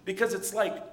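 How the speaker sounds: normal talking voice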